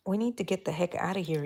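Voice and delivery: normal talking voice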